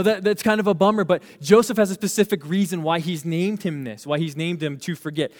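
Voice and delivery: ordinary talk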